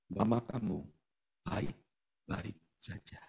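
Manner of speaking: slow, hushed, low and soft; voice of God. Retold or imitated. God reassuring the praying son, hushed and slow. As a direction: breathe it out like a gentle divine whisper. God's voice heard in prayer.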